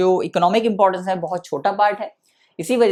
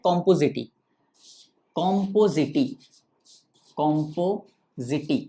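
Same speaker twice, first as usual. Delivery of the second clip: compositae repeated, softer and slower each time, syllables stretched; dictating slowly; 5 semitones lower, 4 dB quieter, much slower than usual. Shown as a demonstration. Teacher dictating the family name to students, slow and soft. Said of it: "और क्या कहा जाता है?".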